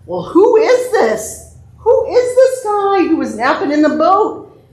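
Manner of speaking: high-pitched, acted amazement; wondering disciples. Disciples ask each other about Jesus, amazed wondering. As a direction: ask it in playful astonishment. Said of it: "That's what they were wondering".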